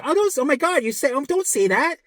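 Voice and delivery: high-pitched, acted pleading dread; put-on panicky protest; mock dismay. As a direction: imitate someone begging not to hear bad news. Acting dismayed sufferer hearing bad news.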